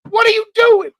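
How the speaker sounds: hammed-up rant at a killer dog, high and loud; yelling at the dog. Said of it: "that would be my mental every time".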